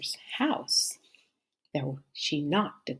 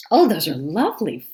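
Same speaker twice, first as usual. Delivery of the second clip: put-on bright, louder girlish delight; delighted cry; 4 semitones higher, 8 dB louder than usual. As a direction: gasp with joy at the flowers.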